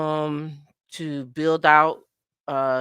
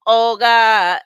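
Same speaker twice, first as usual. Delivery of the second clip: loud, raised Jamaican dancehall shout; 5 semitones higher, 10 dB louder than usual. Acted. Host hyping a viewer's name, loud Jamaican dancehall call. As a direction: belt it like a Jamaican hype man. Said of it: "you gonna be like".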